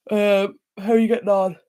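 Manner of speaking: stretched through a yawn. Real, not acted. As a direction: talk mid-yawn. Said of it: "I'm sorry for yawning".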